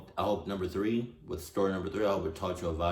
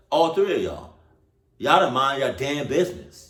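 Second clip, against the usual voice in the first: scolding yell; 6 semitones higher, 6 dB louder than usual. Real, not acted. Scolding the people in the story.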